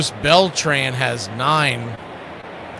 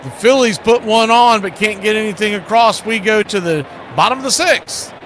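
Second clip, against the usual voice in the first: lively announcer recap; 7 semitones higher, 4 dB louder than usual. Acted. Wraps up the half inning.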